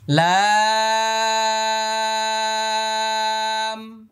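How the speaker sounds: লাম held very long and high, lips closing on m; slow tajweed demo of লাম; letter name drawn out. Shown as a demonstration. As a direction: stretch লাম on one note. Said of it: "আট নম্বর মাখরাজ".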